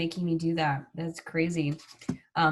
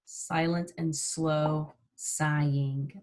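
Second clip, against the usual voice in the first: slow and soft; 3 semitones lower, 4 dB quieter, much slower than usual. Shown as a demonstration. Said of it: "you're silently sighing".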